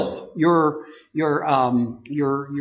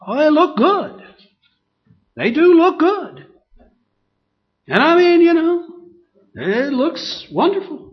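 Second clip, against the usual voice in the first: much higher, louder gushing praise; mock admiration; 10 semitones higher, 4 dB louder than usual. Preacher mocking how good hypocrites look, ironic gushing. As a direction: ironic gushing over their show. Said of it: "See, there's a form".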